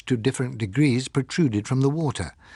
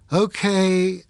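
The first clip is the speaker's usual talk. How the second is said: weary call; 9 semitones higher, 4 dB louder than usual. Acted. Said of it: "I called wearily".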